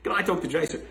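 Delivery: mock eager caller asking for Jason by name; brighter phone caller. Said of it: "This is a true story".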